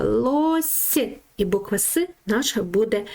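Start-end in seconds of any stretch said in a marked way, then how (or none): 0.0-1.0 "лось" with a clearly soft final s, said high; soft s modelled. Shown as a demonstration. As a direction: stretch the soft s at the end of "лось". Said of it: "Послухайте, будь ласка". (м'який звук С у слові "лось")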